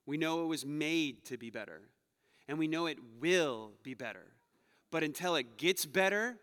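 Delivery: normal talking voice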